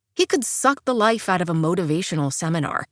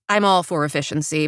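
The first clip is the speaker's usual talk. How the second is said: usual speaking voice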